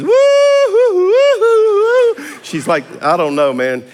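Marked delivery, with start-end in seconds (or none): 0.0-2.2 much higher, louder imitated party whoops; tipsy woo-hoo. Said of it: "this girl comes around making noises".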